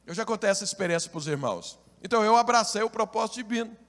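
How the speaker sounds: normal talking voice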